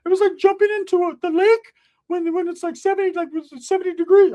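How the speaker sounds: very high comic voice, starting loud; cousin's put-on voice. Cousin reporting on his night, high loud squawk. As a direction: gush it in a high squeal. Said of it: "He's like".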